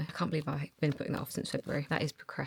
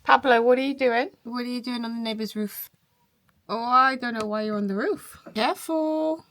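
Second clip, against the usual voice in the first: louder, higher call across the gardens; calling to pet; 7 semitones higher, 13 dB louder than usual. Real, not acted. Owner calling her cat on the neighbour's roof, raised and fond.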